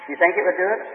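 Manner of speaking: normal talking voice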